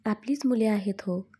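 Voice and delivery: ordinary delivery, nothing marked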